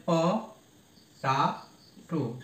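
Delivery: প, টা, টু as isolated syllables with long pauses; teacher separating প টা টু for counting. Shown as a demonstration. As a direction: sound out প ... টা ... টু one at a time. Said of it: "এরকম যদি আমরা pronunciation করি".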